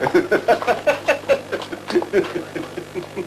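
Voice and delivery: mocking laugh. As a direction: laugh in their faces.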